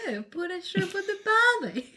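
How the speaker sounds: tossing in a jokey Australian cliché; mock Aussie cliché. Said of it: "G'day, mate".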